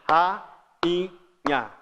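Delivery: "Rainha" as "ra... in... nha"; one beat per syllable in "Rainha", with gaps; evenly separated "Rainha". Shown as a demonstration. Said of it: "Vamos fazer aí com rainha".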